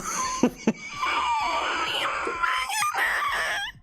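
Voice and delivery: very high squealing laugh; real helpless laughter. Real, not acted. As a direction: keep laughing until it turns into a squeal. Still cracking up.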